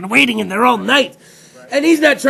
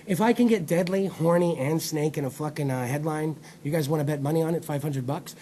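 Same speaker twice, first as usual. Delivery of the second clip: low, soft acted aside; low, sly office bet; 8 semitones lower, 11 dB quieter than usual. Headline writer pitching office bet, low hushed voice. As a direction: drop low and quiet like a discreet wager.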